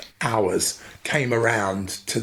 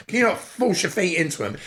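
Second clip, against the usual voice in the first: imitating mum; 8 semitones higher than usual. Mum voiced in story.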